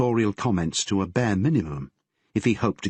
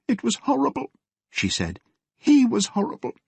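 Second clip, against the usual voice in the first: put-on higher pitched distraught girl voice; young girl on the edge of tears; upset teenage daughter. Daughter upset telling father.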